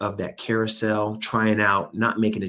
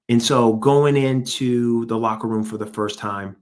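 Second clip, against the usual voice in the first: normal talking voice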